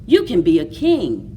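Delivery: ordinary delivery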